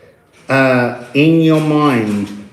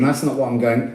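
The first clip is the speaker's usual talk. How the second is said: ordinary delivery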